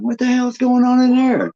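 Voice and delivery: high, alarmed blurt; jolted awake and spooked; startled alarm. Retold or imitated. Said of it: "And I thought".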